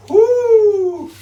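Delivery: long, high, loud "woo"; loud celebratory whoop; whooping cheer. Real, not acted. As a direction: let out a piercing whoop. Cheering at the marshmallows.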